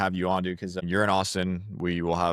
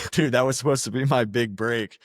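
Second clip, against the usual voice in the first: higher, laughing through a mock lament; 5 semitones higher, 3 dB louder than usual. Real, not acted. To the guest about refunding freelance money, laughing. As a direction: chuckle out the disappointment.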